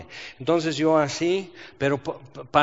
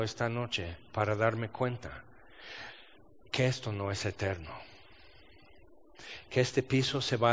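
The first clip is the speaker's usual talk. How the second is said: hushed prayer; 4 semitones lower, 9 dB quieter than usual. Retold or imitated.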